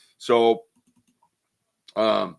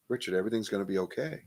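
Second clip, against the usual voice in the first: soft reassuring ghost; 9 dB quieter, faster than usual. Retold or imitated. Ghost lady reassuring child.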